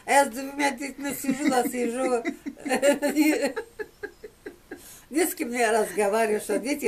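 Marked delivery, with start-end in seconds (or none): 2.3-4.5 belly laugh. Real, not acted. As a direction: laugh hard from the gut. Bursts out laughing at her story.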